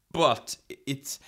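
normal talking voice

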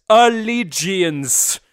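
"Allegiance" drawn out syllable by syllable; very slow, stretched "Allegiance"; slow correction. Shown as a demonstration. Teaching Anders the show's title, slow stretched "Allegiance". Repeats title slowly for co-host.

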